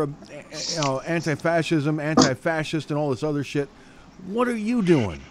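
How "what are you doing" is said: angry rant. Real, not acted. Host ranting at vandals.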